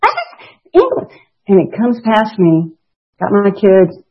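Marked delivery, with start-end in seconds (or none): 0.1-1.1 put-on much higher little-boy voice; be the delighted little boy; toddler voice. The toddler son shouting about the ambulance, a high little-boy voice.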